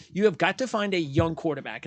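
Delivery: ordinary delivery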